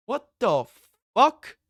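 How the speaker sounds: rising to a loud, high, disbelieving yell; shocked outburst. Real, not acted. Reacting to a video, a loud high shocked yell. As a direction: blurt it out, floored by what you see.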